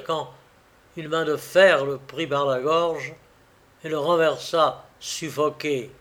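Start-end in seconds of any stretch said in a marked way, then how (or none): none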